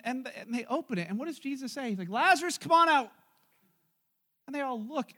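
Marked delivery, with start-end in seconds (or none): 2.1-3.1 high, loud summons; calling out. Acted. Jesus calling dead Lazarus from the tomb, loud summons. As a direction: call him out of the tomb.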